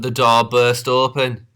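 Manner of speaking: ordinary delivery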